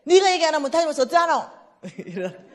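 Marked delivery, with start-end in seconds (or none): 0.0-1.4 loud, much higher worked-up friend; agitated phone call. Retold or imitated. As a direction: push the words out in alarm. Caller pressing on phone.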